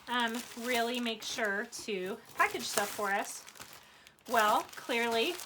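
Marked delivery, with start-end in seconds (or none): none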